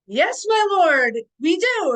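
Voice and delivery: loud, high, drawn-out proclamation; hammed-up courtly call; grand merchant reply. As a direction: answer a lord in booming pageant style. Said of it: "I'm like".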